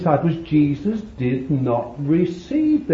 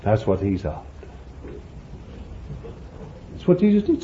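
ordinary talk

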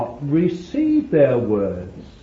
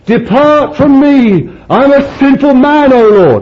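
high, loud anguished plea; pleading cry; 11 semitones higher, 11 dB louder than usual. Acted. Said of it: "Peter falls down at Jesus and he said".

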